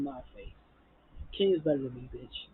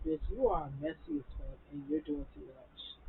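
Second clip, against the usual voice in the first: softer disdainful mutter; judging her under your breath; muttered contempt at a messy friend; side-eye disdain; 7 dB quieter than usual. Retold or imitated. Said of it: "looking at Toya like".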